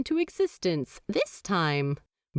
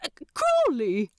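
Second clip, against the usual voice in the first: scandalised angel voice; 5 semitones higher than usual. A narrator's reading.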